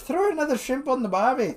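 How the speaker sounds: high-pitched mock Aussie accent; attempted Australian accent. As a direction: put on a silly high Aussie voice. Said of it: "just saying Australian stuff".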